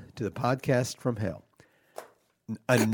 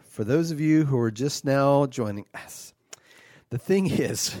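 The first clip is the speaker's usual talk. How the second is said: usual speaking voice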